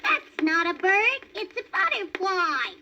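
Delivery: high little rabbit asking; baby rabbit voice. A narrator's reading. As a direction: voice a small eager bunny. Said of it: "said Thumper".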